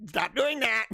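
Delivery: hammed-up "cut it out" protest; mock exasperation. Host joking about Canadian pronunciation, mock exasperation. Acted exasperated reaction to accent.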